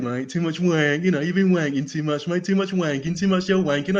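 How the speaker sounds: lightly voiced jeering lad at the pub; mocking laddish bloke. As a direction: tease like an annoying mate. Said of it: "It's like".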